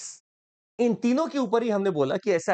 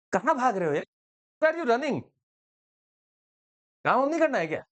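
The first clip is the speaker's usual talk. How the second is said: mock scolding; 4 semitones higher than usual. Jaishankar scolding fleeing Wang.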